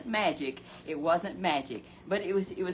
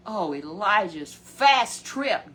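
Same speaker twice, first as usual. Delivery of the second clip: exclamation; 8 dB louder, slower than usual. Real, not acted. Preacher exclaims the swift ascent.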